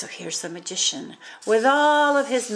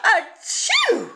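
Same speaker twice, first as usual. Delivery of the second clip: high loud sneeze with a laugh; king sneezes in a cloud of feathers; sneezing king; 12 semitones higher, 5 dB louder than usual. A narrator's reading. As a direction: sneeze it big and playful. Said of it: "wheezed the king".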